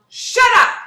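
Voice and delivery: very high, very loud scream. Acted. Toad silences the strange voice, loud scream. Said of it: "screamed Toad".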